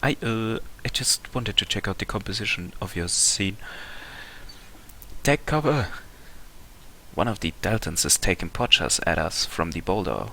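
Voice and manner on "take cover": shouted warning. Acted. Ambushed by a Dalton sniper.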